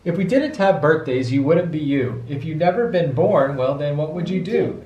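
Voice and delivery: ordinary delivery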